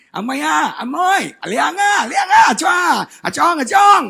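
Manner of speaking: loud, high teasing calls; mocking catcallers. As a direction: imitate hecklers calling out ethnic jeers. Imitates street taunts at Chinese Indonesians.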